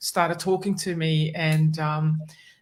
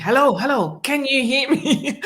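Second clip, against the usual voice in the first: imitating an insistent caller, raised pitch; more pressing; 8 semitones higher, 4 dB louder than usual. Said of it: "it became more pressing".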